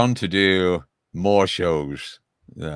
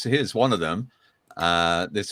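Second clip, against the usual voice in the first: usual speaking voice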